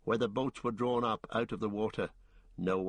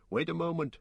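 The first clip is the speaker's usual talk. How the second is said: raised voice, call to wait; 7 semitones higher, faster than usual. Acted. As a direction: call them back. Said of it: "cried Aragorn".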